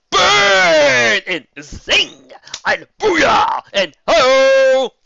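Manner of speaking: loud, high whooping cheers; hammed-up cheering calls; crowd shouts. A narrator's reading. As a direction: shout them like a rowdy crowd.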